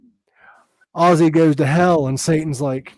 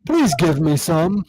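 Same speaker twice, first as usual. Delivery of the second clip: [name]; 3 semitones higher than usual. Retold or imitated. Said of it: "he's like".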